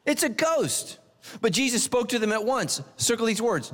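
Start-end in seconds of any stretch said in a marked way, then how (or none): 0.0-1.0 high mock cry of fright, pitched way up; a hint of panicked disciples crying out; frightened cry. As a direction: cry out like terrified sailors. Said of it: "they cried out".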